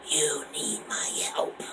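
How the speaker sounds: slow eerie whisper; strange oracle voice. Acted. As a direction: breathe it like a mysterious seer. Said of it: "He has a strange voice".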